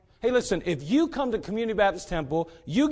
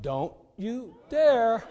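slow warning; 4 semitones higher, much slower than usual. Retold or imitated. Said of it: "And she went".

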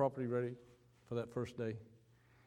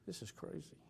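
low, soft muttered "This is crazy"; 5 semitones lower, 11 dB quieter than usual. Retold or imitated. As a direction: say it under your breath. Muttered disbelief in story.

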